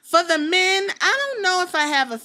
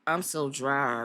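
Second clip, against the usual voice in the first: bored and drained; 8 semitones lower, 3 dB quieter, much slower than usual. Retold or imitated. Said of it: "and I just be like".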